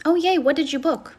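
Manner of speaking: ordinary talk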